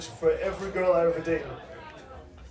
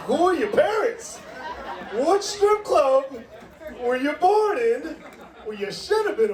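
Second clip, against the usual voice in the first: much higher, slow, laughing disbelief; mock outraged quote; 7 semitones higher than usual. Host mocks a crowd member's name, high and laughing. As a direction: pretend to grill the parents through laughter. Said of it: "And I was like".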